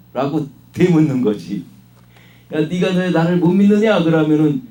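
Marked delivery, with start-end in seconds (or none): 2.5-4.0 a louder, raised rebuke; stern rebuke. Retold or imitated. Jesus rebuking the unbelieving listeners, stern and raised. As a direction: scold them for their unbelief. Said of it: "못 믿는 것을 책망하는 말".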